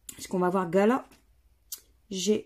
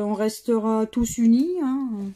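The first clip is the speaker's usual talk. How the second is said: usual speaking voice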